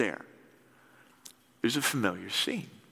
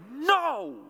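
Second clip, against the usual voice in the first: grumpy retort; 6 semitones higher, much slower than usual. Retold or imitated. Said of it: "And Peter's like".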